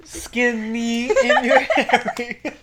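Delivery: slow, high words broken by laughter; giggling through the joke; laughing. Real, not acted. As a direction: crack up mid-phrase.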